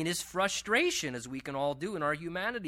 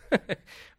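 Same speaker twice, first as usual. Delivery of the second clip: amused chuckle; 4 semitones higher than usual. Real, not acted. Laughs at Job's sarcasm.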